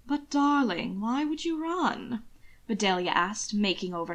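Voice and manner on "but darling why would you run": higher, slow cooing. A narrator's reading. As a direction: coo over him sweetly. Bedelia fawning over Will.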